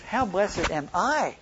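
I stretched out high, then falling; slow, emphatic reading landing on I; stress on I. Shown as a demonstration. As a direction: lean long and hard on the final I. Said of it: "then also emphasize different perspectives".

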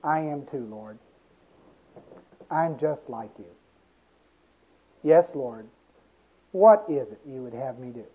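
bow your head and say it softly; humble answer. Acted.

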